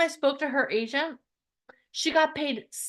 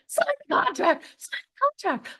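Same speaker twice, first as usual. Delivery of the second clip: urgent insistence; 8 semitones higher than usual. Retold or imitated. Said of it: "I said".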